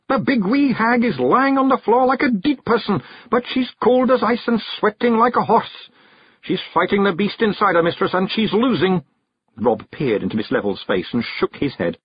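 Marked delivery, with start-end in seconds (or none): 0.1-9.0 high, urgent Scottish alarm; Rob Anybody yelling. A narrator's reading. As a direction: press her frantically as a tiny Scot. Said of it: "Rob Anybody yelled".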